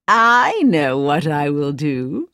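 slow, sly plotting; lightly voiced scheming queen. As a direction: savour the wicked plan. Queen hatches a plan.

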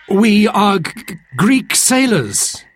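high, stammering scared sailor; frightened stammer. Acted. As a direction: plead nervously, tripping on first sounds. Frightened sailor answers the monster.

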